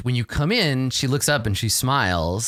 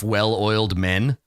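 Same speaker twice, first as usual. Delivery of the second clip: ordinary delivery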